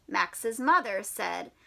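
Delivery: ordinary delivery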